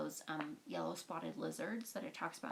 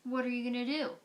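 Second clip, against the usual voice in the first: joking; 6 semitones higher, 7 dB louder, faster than usual. A narrator's reading. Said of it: "Catherine joked".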